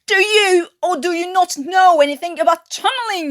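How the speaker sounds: high loud furious tirade; exploding in rage. Acted. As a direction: tear a strip off him. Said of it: "Davis exploded".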